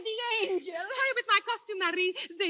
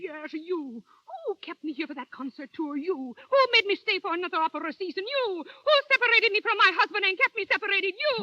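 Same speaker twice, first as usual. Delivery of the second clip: loud, accusing tirade; angry; 4 dB louder than usual. Acted. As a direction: hurl each accusation at him. She blames her manager.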